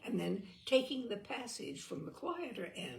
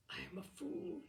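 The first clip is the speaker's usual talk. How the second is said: inner thought; 5 semitones higher, 8 dB quieter than usual. Acted. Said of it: "he thought".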